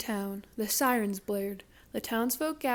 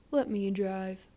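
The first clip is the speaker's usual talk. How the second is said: weary sigh; 2 semitones lower than usual. Acted. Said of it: "Mabel sighed".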